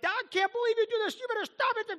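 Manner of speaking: high, fast mock mother scolding; mama yelling. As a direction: scold like an exasperated mom. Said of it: "your mama yelling at you".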